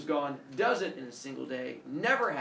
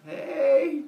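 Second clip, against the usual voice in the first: calling out; 12 semitones higher, 8 dB louder than usual. Real, not acted. Teacher calls out to student.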